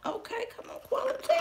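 high breathy whisper; whispered hype. Real, not acted. Vlogger quietly hypes the tea quality, whispered. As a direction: hype it under your breath.

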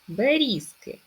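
"Берізки", pitch lifted to show stressed рі. Shown as a demonstration. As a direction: lean on the і in "Берізки". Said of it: "наголос на і".

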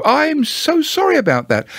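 very high, sorry little voice; sympathetic bear. A narrator's reading. Pooh consoling gloomy Eeyore, sympathetically.

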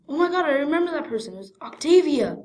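high, loud pretend shock; sudden realisation. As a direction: blurt it out as a startling discovery. Pretend realisation of who it was.

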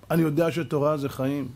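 ordinary talk

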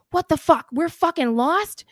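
loud, exasperated impersonation; retold swearing outburst. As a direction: imitate an angry paramedic cursing at the driver. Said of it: "He just starts screaming and screaming".

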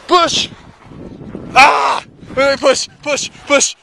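loud, high urging to push; urgent shouting. Real, not acted. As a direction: yell to keep the sled moving. Urging sled forward.

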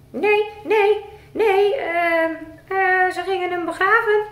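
high, loud sing-song puppet voice. Acted. Puppet Rick singing his answer to the presenter.

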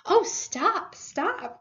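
high, delighted protest; exclaimed plea, starting high; overwhelmed cry. A narrator's reading. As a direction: beg for a halt, overcome. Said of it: "cried the Mole".

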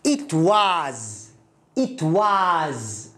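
it was read slowly, word by word; English it was. Shown as a demonstration. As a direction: model it was twice for the learner. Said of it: "كيف تقرأ هذه الكلمة".

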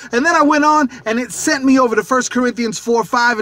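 usual speaking voice

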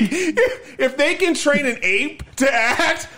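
high, laughing imitation of the character; laughing quote. Retelling a joke character's line to a friend, laughing.

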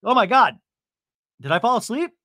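loud startled jolt awake; mock waking up. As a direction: jerk awake, loud and fast. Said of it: "I was hearing Teddi's voice, and I just conked out".

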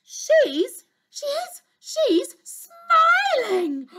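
the queen cries out in delighted disbelief; excited cry. Acted. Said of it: "cried the queen".

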